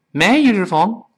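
fast, linked casual English; fast casual speech. Shown as a demonstration. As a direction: toss it off quickly like everyday talk. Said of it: "语速特别快".

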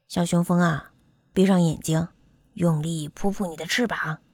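low-pitched doctor character voice; kindly doctor. Acted. Doctor prepares to examine the bumblebee, low and kindly. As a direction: speak low, like an attentive doctor.

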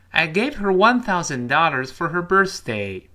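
teacher's emphatic read-along delivery; model reading for repetition. Shown as a demonstration. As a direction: read it out clearly for students to repeat. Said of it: "跟老师再读一遍".

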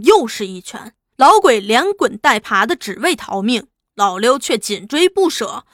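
ordinary delivery

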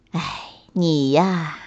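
low motherly sigh; resigned. A narrator's reading. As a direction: sigh helplessly at your child. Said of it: "再一次无奈地摇了摇头".

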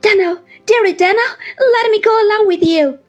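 put-on high, loud, crying old widow's plea; tearful pleading. A narrator's reading. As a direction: beg to be taken along, sobbing into it. Mrs Gummidge's long tearful plea.

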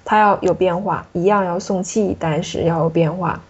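ordinary talk, nothing marked

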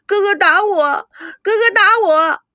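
building wail. A narrator's reading. Sister cries to grandparents that brother hit her, slow building wail.

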